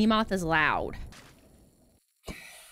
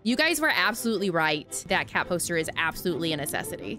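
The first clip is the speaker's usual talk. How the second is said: louder recorded recap voice; louder recap voiceover; voiceover narration; 5 dB louder than usual. Acted.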